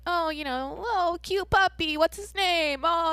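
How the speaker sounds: high sweet cooing over a cute puppy; cooing homeless man. Retold or imitated. An older homeless man coos high and sweet at the puppy. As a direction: coo up high like a gentle old man meeting a puppy.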